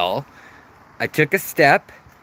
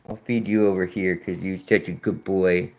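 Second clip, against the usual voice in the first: soft pet praise; 6 semitones lower, 5 dB quieter than usual. Real, not acted. Praising fish while feeding.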